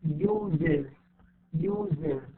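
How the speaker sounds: "uses" as two syllables, use-iz; extra IZ syllable. Shown as a demonstration. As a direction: say "uses" with an iz ending. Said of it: "إي زد".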